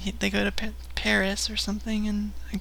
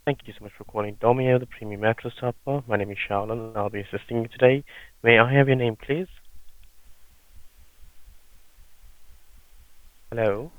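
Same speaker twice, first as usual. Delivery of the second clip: call center agent; 10 semitones lower, much faster than usual. Acted. Prank call, plays sales agent.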